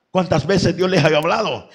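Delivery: ordinary delivery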